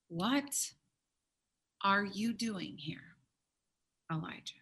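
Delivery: soft, slow whisper, dropping low; gentle whisper. Acted. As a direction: whisper it tenderly, like God's still small voice. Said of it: "a peaceful question".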